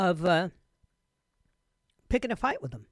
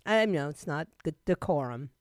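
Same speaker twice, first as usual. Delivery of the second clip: normal talking voice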